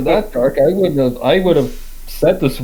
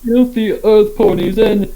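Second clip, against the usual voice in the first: higher, lightly voiced sneering aristocrat; snooty rich boy; 6 semitones higher than usual. Still mocking Blue Blood as a snooty rich boy.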